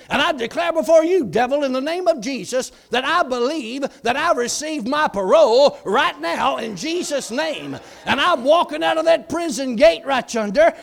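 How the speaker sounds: loud, high proclamation, preached at full force; bold declaration. Retold or imitated. Prisoner declaring his parole before God, preached loud. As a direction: thunder it out like a preacher.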